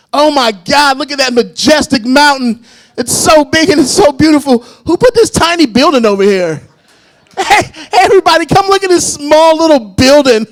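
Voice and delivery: high, loud gushing tourist; hammed-up wonder at a big mountain; awed onlookers. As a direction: gush over the mountain like amazed sightseers. People admiring a mountain.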